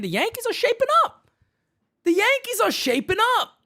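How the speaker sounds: quick and high, all hype; hyped announcement. Real, not acted. Host hyping his team to the stream, fast and high. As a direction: fire it off like breaking news.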